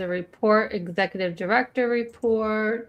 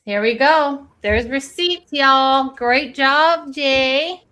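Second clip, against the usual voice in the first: loud, higher and triumphant; loud victorious cheer to a friend; excited gloating; 5 semitones higher, 8 dB louder, slower than usual. Real, not acted. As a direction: crow over the receipts you just found. Triumphant find of the evidence.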